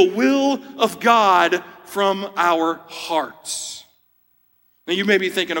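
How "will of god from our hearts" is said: slow emphasis. Real, not acted. Stressing the sermon's key point.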